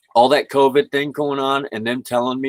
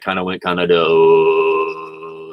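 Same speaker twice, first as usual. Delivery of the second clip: slow bass drone on the last word; deep voice demo; 6 semitones lower, much slower than usual. Shown as a demonstration. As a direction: drop into a long, low held note. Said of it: "My voice actually sounds a bit deeper too".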